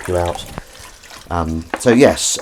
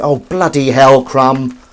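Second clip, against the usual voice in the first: loud, high, drawn-out exclamation; exasperated outburst; exasperated scolding; 6 semitones higher, 9 dB louder, slower than usual. Real, not acted. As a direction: scold in dismay at a mess. Scolding after finding a mess.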